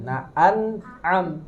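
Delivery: an'am drawn out slowly with a catch for ain. Shown as a demonstration. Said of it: "Pakai ain yang amnya pakai ain". ("an'am" pelan dengan ain yang jelas)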